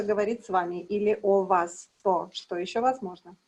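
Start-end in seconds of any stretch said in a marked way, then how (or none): none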